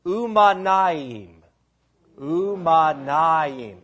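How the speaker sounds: Umanaim said very slowly as oo-mah-nah-eem; Hebrew word. Shown as a demonstration. Pastor teaching the Hebrew word syllable by syllable. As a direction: draw out every syllable of Umanaim. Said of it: "Behold how good".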